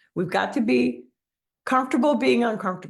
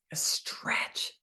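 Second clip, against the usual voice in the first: soft unvoiced whisper; 9 dB quieter than usual. Real, not acted. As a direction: breathe it out while stretching. Coach demonstrating power pose.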